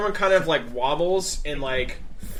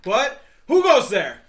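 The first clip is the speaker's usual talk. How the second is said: loud, high guard challenge; startled guard shout; 9 semitones higher, 9 dB louder than usual. Acted.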